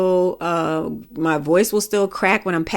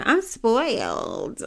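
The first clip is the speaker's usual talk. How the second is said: very drawn-out, higher mimicking voice; mocking spoiled brag; 4 semitones higher, much slower than usual. Speaker mimics people who say they're spoiled, mocking drawl. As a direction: stretch it out to make fun of the boast. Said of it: "I hate when people say they're spoiled".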